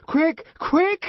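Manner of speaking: a man shouting for the nurse in panic; shouted. A narrator's reading. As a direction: yell for help in a panic. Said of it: "he shouted".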